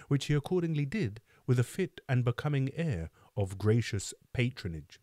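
plain reading voice

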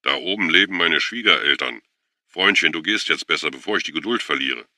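loud, firm warning; emphatic threat with a civil edge; almost friendly but emphatic. A narrator's reading. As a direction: warn him off forcefully, nearly friendly. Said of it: "Er sagte das sogar fast freundlich, aber mit Nachdruck".

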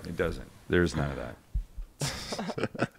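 whispered aside. Real, not acted. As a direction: drop to a slow whisper. Quiet aside after joke.

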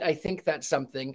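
ordinary delivery

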